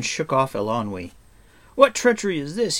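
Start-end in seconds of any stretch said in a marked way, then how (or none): 1.8-2.8 high, fast, loud accusation; shouting. A narrator's reading. Taran turning on Eilonwy over treachery, shouting. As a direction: demand it in an outraged rush. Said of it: "he shouted".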